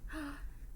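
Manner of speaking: gasp. Acted. Papa Bear sees bowl.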